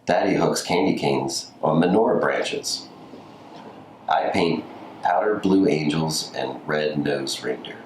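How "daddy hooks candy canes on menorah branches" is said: ordinary delivery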